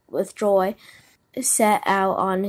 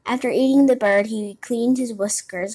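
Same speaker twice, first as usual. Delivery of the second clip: ordinary delivery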